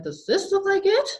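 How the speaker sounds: high, fast teasing clown voice. A narrator's reading. Clown taunting Jessica about her dog, fast high tease. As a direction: tease her quickly and brightly.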